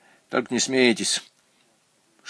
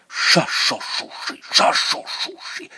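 toneless whispered clacking syllables; typewriter whisper; 6 semitones higher, slower than usual. Acted. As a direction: tap the syllables out in a whisper like keys. Машинка сама застучала. (шёпот, как стук машинки)

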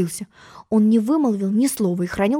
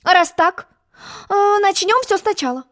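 quick agreement; 11 semitones higher than usual. A narrator's reading. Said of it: "быстро согласился".

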